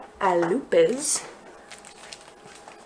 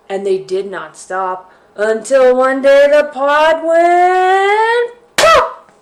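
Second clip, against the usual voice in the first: rising higher and louder to pop; building excitement; 6 semitones higher, 11 dB louder, much slower than usual. Acted.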